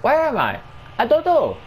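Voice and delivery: squeaky high confused voice; high silly voice. Pretend. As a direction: play dumb in a high voice. Silly lost voice.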